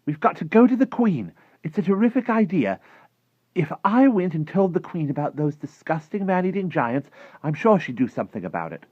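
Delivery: high, loud, eager pitch of a plan; thrilled, high-pitched persuasion; excited girl. Acted. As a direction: enthuse in a girl's voice. Sophie excited by her idea.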